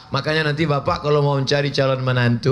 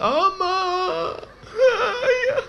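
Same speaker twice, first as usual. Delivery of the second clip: much higher, sobbing wail; crying daughter; slower than usual. Acted. Newly wed daughter crying to her father, high sobbing wail. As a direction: wail for your father like a weeping bride. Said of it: "anak pun balik menangis".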